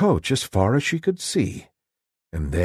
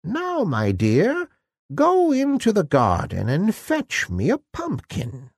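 put-on high, croaky old woman giving orders; old fairy godmother; 8 semitones higher than usual. A narrator's reading. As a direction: send her off in a high old crone voice. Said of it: "The fairy took Cinderella by the hand and said".

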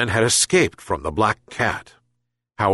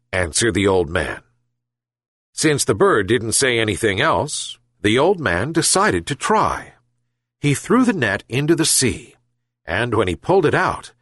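usual speaking voice

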